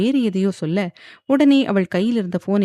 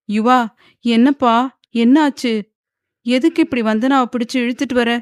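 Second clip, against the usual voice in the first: higher, louder worried questions; alarmed questioning; 5 semitones higher, 4 dB louder than usual. A narrator's reading. Mother to son dragging his wife in, alarmed. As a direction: ask in alarm.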